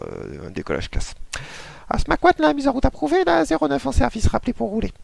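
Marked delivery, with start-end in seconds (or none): 1.9-4.9 very high squeaky controller voice; control tower voice. Acted. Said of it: "la voix du contrôleur".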